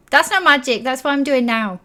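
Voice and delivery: put-on indignant child letting the volume slip up; forgetting to whisper. Child forgetting auntie is near, loud high protest. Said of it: "hear slightly louder as if she's forgotten to whisper".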